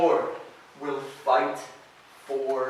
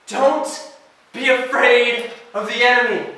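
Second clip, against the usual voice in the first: "Don't be afraid of the enemy" called out much higher and louder, hitting "afraid"; full-voiced proclamation; 8 semitones higher, 9 dB louder than usual. Shown as a demonstration. Preacher to students, proclaiming "Don't be afraid of the enemy". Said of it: "Nehemiah can say that".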